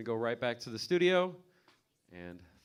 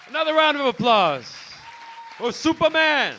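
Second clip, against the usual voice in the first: shouting; 16 dB louder than usual. Real, not acted. Host hypes crowd.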